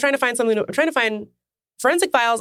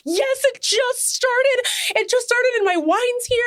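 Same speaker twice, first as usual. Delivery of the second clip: much higher, slow, thrilled squeal; giddy excitement; 13 semitones higher, slower than usual. Retold or imitated. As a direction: gush like a delighted fan. Retold self, thrilled about movie.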